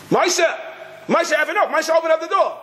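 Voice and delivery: high, frantic shouting through the door; yelling at husband. Retold or imitated. Locked-out wife yells at husband through door, high shout. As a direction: pound on the door with your voice.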